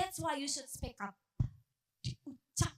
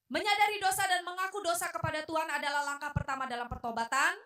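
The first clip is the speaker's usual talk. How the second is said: loud, fast, higher reading voice; formal read-aloud; 5 semitones higher, 6 dB louder than usual. Real, not acted. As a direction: read the statement out briskly and loudly. Reads out a teaching point. (membaca lantang dan cepat)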